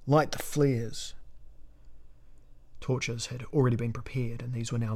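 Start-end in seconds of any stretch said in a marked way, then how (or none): 0.1-1.1 drawn-out, slow called order; a raised call, held back from a full shout; shouted command. A narrator's reading. Elric orders his men to light up, shouting slowly. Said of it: "shouted Elric".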